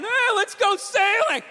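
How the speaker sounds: high, slow chipper call. Retold or imitated. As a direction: play a cheery clueless boater.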